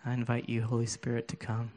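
soft, unhurried prayer over the room; quiet prayer. Real, not acted. Preacher praying softly over his hurting listeners, hushed prayer. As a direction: pray gently, inviting the Spirit in.

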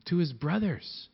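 ordinary talk

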